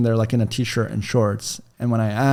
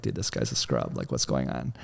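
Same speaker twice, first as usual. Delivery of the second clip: much lower, much softer muttered scoff; scornful father-in-law; 11 semitones lower, 12 dB quieter than usual. Retold or imitated. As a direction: sneer at him under your breath like a judging dad. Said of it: "will see it and be like".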